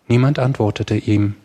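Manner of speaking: plain reading voice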